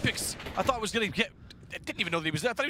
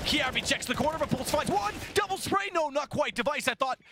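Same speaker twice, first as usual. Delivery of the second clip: excited caster; 8 semitones higher than usual. Real, not acted. Fast action, live commentary.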